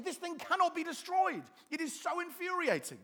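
high, loud mock exasperation; acted outburst of frustration, pitched high; infuriated gardeners. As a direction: rail like a thwarted gardener. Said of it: "they make gardeners so angry".

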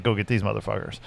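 muttered order. Retold or imitated. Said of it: "He's like".

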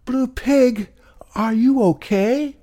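high piglet calling out loud; shouting. Acted. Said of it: "She shouted out".

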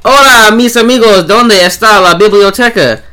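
loud, high mock-Spanish show opener; hammed-up Spanish greeting. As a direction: belt out a big, silly Spanish welcome. Host opens show in jokey Spanish.

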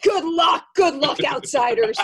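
loud, hammed-up call, slowing down; mocking send-off. Local jeering at lost outsiders, loud mocking call. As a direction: holler it as a mock farewell.

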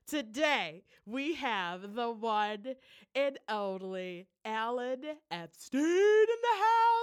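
slow, loud big announcement; announcer reveal. Acted. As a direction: present the guest like a ring announcer. Announcing the guest's arrival.

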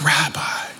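a hushed, awestruck whisper; breathless whisper. Acted. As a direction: barely breathe the name out. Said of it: "her breath is taken away".

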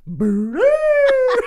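high, loud cartoon creature call; Grimace call. Grimace answering the husband with a high loud hoot. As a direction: hoot it like a goofy mascot.

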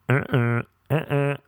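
short two-note honk; vocal car-horn imitation; car horn noise. Shown as a demonstration. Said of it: "a car horn".

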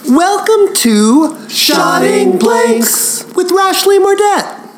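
hammed-up high-pitched host intro; Ashley Burdett spoof. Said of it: "No, it isn't Rashleigh Mordette".

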